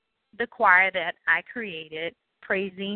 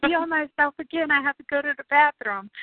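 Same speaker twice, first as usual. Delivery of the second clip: put-on high whiny little girl; nervous child; 9 semitones higher than usual. Nervous child begging grandmother before the show, high whine. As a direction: whine like a jittery seven-year-old. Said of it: "I'm like".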